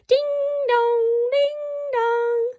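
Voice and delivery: sing-song ding dong, pitched high like a bell; bell chime. Acted. The town bell wakes the people of Tralee, high sing-song chime.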